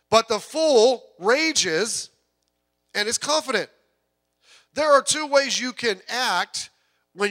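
full-force preaching. Acted. Pastor preaching to the congregation about fleeing sin, full force. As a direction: drive each point home from the pulpit.

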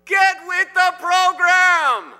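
high, drawn-out angry bellow from a coach across the field; furious coach shout. Acted. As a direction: shout at the top of your lungs. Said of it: "he shouted at the top of his lungs".

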